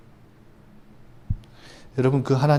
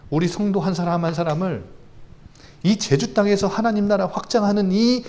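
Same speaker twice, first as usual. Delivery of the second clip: ordinary talk, nothing marked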